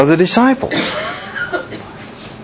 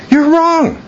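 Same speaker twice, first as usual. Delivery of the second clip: very high, held-up acted objection; mock contradiction; 14 semitones higher than usual. Peter's first reaction to Christ, mock contradiction. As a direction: pitch it way up as a retort.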